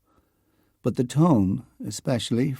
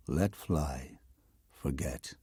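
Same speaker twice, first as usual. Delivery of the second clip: fading to a whisper; 6 semitones lower, 8 dB quieter, slower than usual. Acted. Joyce's ghost urging the poet to let go, fading to a whisper. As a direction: drop softer and softer until it is only breath.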